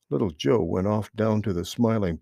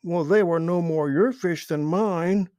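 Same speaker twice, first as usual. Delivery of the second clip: a touch higher, lightly voiced protest; 8 semitones higher, 3 dB louder than usual. A narrator's reading. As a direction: push back mildly at a friend. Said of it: "protested Billy Mink".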